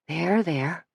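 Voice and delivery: soft, soothing comfort. A narrator's reading. Suzanne cradles the sobbing girl, soft and soothing. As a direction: hush her gently like a crying child. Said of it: "trying to comfort her".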